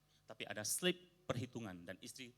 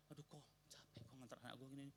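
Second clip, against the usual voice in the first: muttered tired grumble, much softer; mock complaint; 4 semitones higher, 13 dB quieter than usual. Guest grumbling to the pastor about the school run, softly. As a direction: grumble it under your breath, worn out. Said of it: "Dia ngeluh lagi".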